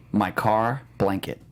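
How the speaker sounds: ordinary delivery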